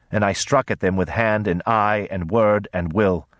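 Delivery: ordinary delivery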